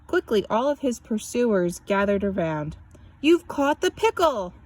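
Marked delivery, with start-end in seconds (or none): 3.2-4.5 excited cry. A narrator's reading. The pursuers cheer at the boy who caught the pickle. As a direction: cheer the boy's catch. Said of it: "they all cried to the boy".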